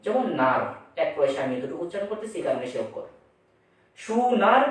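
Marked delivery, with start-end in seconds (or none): 4.0-4.6 সুনার said clearly with a rising end; syllable demo. Shown as a demonstration. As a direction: say সুনার to show its two syllables. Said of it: "সু হচ্ছে মুক্তাক্ষর আর নার হচ্ছে বদ্ধাক্ষর".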